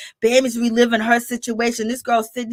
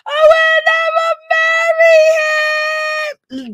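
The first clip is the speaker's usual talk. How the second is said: high, shrieking mock sob, louder and slow; exaggerated shrieking fake tears; mock wailing outrage; 4 dB louder than usual. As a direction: wail it like a hammed-up soap star. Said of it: "She was like".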